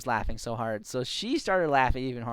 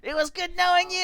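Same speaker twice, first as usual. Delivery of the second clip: high, loud mock last words; dramatic farewell; 6 dB louder than usual. Teammate diving to her doom, dramatic farewell. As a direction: shout a hammed-up goodbye.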